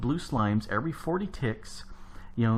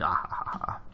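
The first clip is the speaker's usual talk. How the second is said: breathy whispered villain laugh; whispered evil cackle; 4 semitones lower than usual. Retold or imitated. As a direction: snicker it under your breath like a villain. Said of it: "I'm evil that way".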